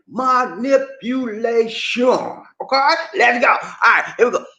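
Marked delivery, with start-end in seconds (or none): 0.1-2.4 "Manipulation" very slow, each syllable stretched and higher. Shown as a demonstration. Leading the count so all say it together, "Manipulation" drawn out slowly. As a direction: lead a group through "Manipulation" syllable by syllable. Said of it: "Can we do it together?".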